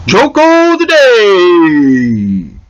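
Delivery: long, drawn-out loud announcer call; segment announcer. Pretend. Host launching the joke segment, big drawn-out announcement. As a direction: belt it out and stretch the last word.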